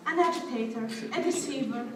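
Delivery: usual speaking voice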